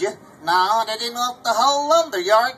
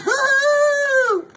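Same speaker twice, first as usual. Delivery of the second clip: cheer; 5 dB louder than usual. Acted. Cheer after song.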